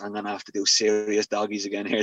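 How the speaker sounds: retold low-voiced grumble; grumbling complaint. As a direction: imitate a mate moaning under his breath. Mate Jenks grumbles in retold quote.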